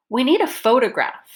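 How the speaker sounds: "photograph" stressed with a pitch jump and fall; focus stress. Shown as a demonstration. Teacher models a practice sentence, focus stress on "photograph". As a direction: lift and lengthen the first syllable of "photograph". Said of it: "pronounce it with the pitch change".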